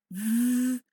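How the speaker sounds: long, buzzing V, voice box on; voiced v sound. Shown as a demonstration. Teacher shows students the changing sounds, voiced V held long. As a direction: hold V as a loud voiced buzz. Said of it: "definitely a voiced sound".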